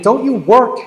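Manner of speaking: loud, high, outraged question; indignant. Retold or imitated. Bag boy to lazy young golfers, loud and indignant. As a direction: fire it at the idle golfers like an angry bag boy. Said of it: "And I was mad".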